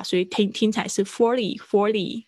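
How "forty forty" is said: "forty" with t softened to a quick d-like flap; voiced T. Shown as a demonstration. Teacher explaining the sound change, flapped "forty". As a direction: soften the t in "forty" toward a tap. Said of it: "它会变浊化".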